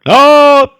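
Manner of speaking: sudden loud, high yell; a frightening outburst of a shout; startling shout. Shown as a demonstration. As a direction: blast it out to make them jump. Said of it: "essa gritaria?".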